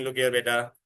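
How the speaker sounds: usual speaking voice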